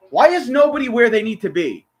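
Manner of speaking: high, loud exasperated demand; exasperated outburst. Real, not acted. Fan upset at his team's fielding, exasperated shout. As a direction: burst out in real frustration at the team.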